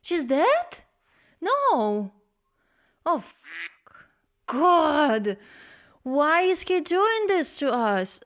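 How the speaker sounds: high, loud, stunned outcry; real shock, pitch jumping up; shocked disbelief. Real, not acted. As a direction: react in horror to a plot twist. Shocked reaction to the song's plot.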